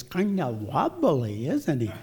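drawn-out, playful rabbit impression; Thumper. Rabbit watching Bambi fall over, playful character voice. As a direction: stretch the first words with a cartoon lilt. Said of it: "Thumper the rabbit says".

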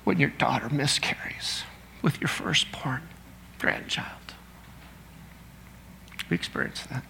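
whispered sorrow; hushed whisper. Real, not acted. Grief example, hushed sermon aside.

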